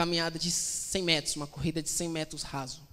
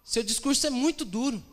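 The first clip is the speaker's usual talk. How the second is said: higher-pitched complaint from the crowd; acted protest; 6 semitones higher than usual. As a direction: grumble as an offended follower, voice raised in pitch. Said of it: "as pessoas disseram".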